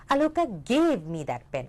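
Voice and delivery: GAVE drawn out and punched, falling after; slow line leaning hard on gave; stress on GAVE. Shown as a demonstration. Teacher showing it was given, nothing else, stress on gave. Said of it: "I lay emphasis on different words".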